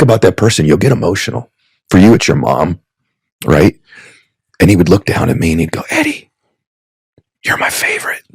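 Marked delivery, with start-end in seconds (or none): 5.9-8.2 high, hushed and soft, much softer; grandpa's tender whisper. Retold or imitated. Grandfather whispering praise to his little grandson, high and hushed. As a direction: breathe it like a doting grandfather. Grandfather voiced in a retold memory.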